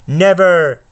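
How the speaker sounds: defiant shout. Acted. Harry refusing Voldemort, defiant shout. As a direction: fling it back at him.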